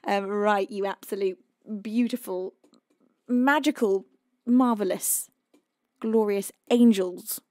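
ordinary talk

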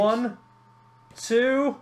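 high, loud count; excited pin count. Real, not acted. Counting the deciding title pin.

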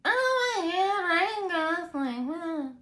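high, slow swooning fan voice; gushing fangirl. Pretend. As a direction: gush over him like a starstruck fan. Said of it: "Can you do that one more time?".

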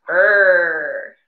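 held, low angry "er" sound; mock-angry growling "errr". Shown as a demonstration. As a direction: draw out "er" like you're angry. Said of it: "Like you're angry or something".

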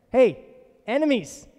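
God telling enemies to halt; booming divine warning; commanding rebuke. Acted.

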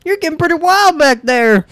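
high, put-on boyfriend voice; boyfriend up front. Boyfriend calling to the back of the RV, high mock voice. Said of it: "the boyfriend up front's like".

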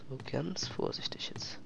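breathy sneaking whisper. Real, not acted. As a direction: tiptoe it in a whisper.

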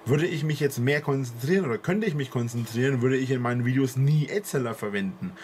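ordinary talk

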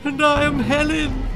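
put-on high, tearful girl's voice; weeping. A narrator's reading. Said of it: "wept Helen".

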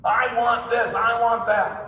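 high mock toddler demand; lightly voiced greedy toddler; toddler wanting. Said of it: "natural thing to say... when you're little".

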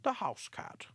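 high-pitched, slightly nervous chairman; an old mouse chairing an uneasy meeting. Acted. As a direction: call the floor with nervous formality. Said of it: "a bit nervously".

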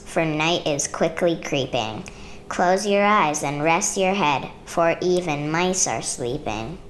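gentle sing-song picture-book reading; reading to children. A narrator's reading. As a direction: read it like a bedtime story to a child.

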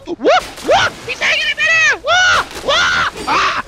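very high, loud yelps of shock; squawking, incredulous shouts; shrieking disbelief. Real, not acted. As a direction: yelp in utter astonishment. Shocked enemy hangs in midair.

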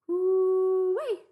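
high, very loud drawn-out cooee; long bush call. A narrator's reading. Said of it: "her father gave one last call".

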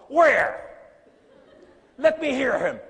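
loud, high, incredulous demand; exigence rejouée. Retold or imitated. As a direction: call out as if challenging them to prove it. Acts out his demanding reply.

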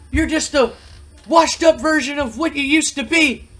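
high-pitched, loud mocking manager voice; Bill Alfonso taunting. Bill Alfonso taunting Rob Van Dam to rejoin, high loud mocking voice. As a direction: sneer at him from way up high, like a whiny heckling manager. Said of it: "And he goes".

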